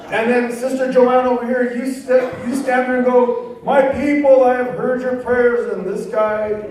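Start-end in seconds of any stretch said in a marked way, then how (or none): 3.6-5.7 mock prophecy. Mimicking prophesying church member.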